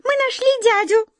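very high tiny mouse voice; high-pitched squeaky little creature; mouse squeak. A narrator's reading. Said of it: "пискнула одна из них".

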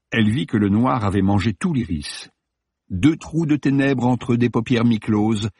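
ordinary delivery, nothing marked

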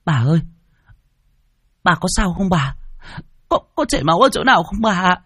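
put-on high, crying child's voice; tearful. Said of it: "miệng mếu méo sạch đi".

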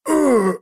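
sharp high grunt of pain. Acted. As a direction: grunt like a broken jaw. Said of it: "замычало надо мной". (мычание от боли)

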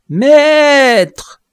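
high, loud, pained cry; dwarf calling up from the abyss, begging; desperate plea. Acted. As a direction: wail for the master to come back. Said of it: "suppliante et douloureuse".

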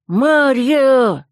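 a voice calling out of thin air; disembodied voice. Acted. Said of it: "Голос из ниоткуда раздался". (голос из ниоткуда)

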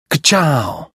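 playful self-admiration; cocky catchphrase. A narrator's reading. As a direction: toss out your signature word. McQueen's catchphrase, admiring himself.

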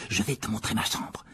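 soft, low confidential aside; whispering. Acted. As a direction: keep it hushed between friends. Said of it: "chuchota Ron à l'oreille de Harry". (chuchoter à voix basse)

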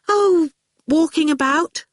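high-pitched, airy reply; dreamy. A narrator's reading. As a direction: answer as if lost in daydreams. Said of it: "Fifi answered dreamily".

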